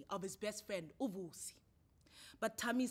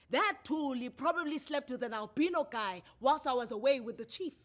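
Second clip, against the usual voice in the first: raised, bitter man's voice; bitter accusation; 5 semitones higher, 13 dB louder than usual. A narrator's reading. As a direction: spit out the accusation loudly. Tammy accuses Thuli.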